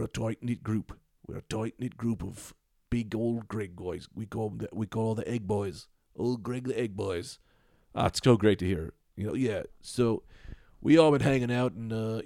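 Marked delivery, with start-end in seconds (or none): none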